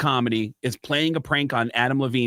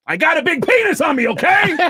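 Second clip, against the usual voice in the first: loud, very high defensive outburst; exasperated confession; 7 dB louder than usual. Acted. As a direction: blurt it out as a hysterical yell. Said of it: "he walks in and goes".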